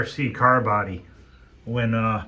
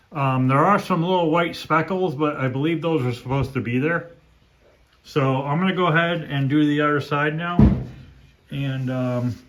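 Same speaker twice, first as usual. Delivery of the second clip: louder, higher, brush clenched in the teeth; paintbrush in mouth; 5 semitones higher, 5 dB louder than usual. Real, not acted. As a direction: talk through a paintbrush held in the mouth. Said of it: "now I can talk normal".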